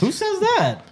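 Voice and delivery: high, disbelieving question; who on earth says that; incredulous. Real, not acted. Incredulous retort.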